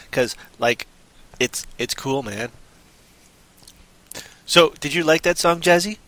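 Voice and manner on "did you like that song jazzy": normal talking voice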